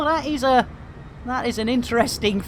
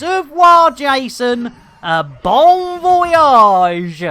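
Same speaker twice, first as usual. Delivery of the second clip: mock farewell; 5 semitones higher, 6 dB louder, slower than usual. Waving a player off.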